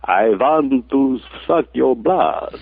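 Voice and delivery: hammed-up Dracula line, drawn out; Bela Lugosi. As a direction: do an admittedly awful movie vampire. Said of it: "that's about as close as I could get".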